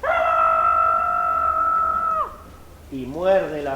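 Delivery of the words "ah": very high, loud squeaky yell; a tiny ant roaring as it bites; little ant's high war cry; tiny ant battle yell. Acted. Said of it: "Y abre la boca así".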